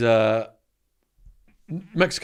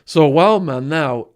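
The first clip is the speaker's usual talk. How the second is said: slow and louder, leaning on Wild Man; slow dramatic emphasis; 4 semitones higher, 8 dB louder, much slower than usual. Acted. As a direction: draw out So Wild Man now. Slow loud naming of alter ego.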